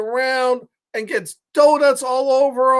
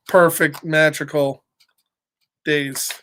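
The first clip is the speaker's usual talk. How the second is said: gentle reassurance; 6 semitones lower than usual. A narrator's reading. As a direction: settle them down gently. Gentle moral repeated.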